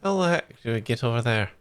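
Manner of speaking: tired, inconsistent mock Scottish accent; bad Scottish accent. Shown as a demonstration. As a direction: attempt Scottish and let it slip in and out. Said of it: "five minutes of a bad Scottish accent".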